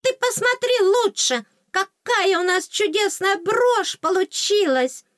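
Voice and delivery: very high, loud, drawn-out squeak; squealing fairy. A narrator's reading. Fairy shows off the new brooch, excited squeal. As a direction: squeal in delight like a tiny fairy. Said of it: "вдруг как запищала".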